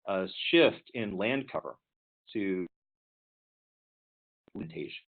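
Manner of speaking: choppy connection dropping words; bad line, audio breaking up; voice cutting out. Real, not acted. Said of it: "your voice is cutting in and out".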